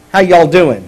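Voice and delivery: loud, casual Texan hello; Texan greeting. Shown as a demonstration. Pastor showing singular y'all to the congregation, Texan greeting. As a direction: greet one person in easy Texan. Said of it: "practice your Texan because y'all is singular".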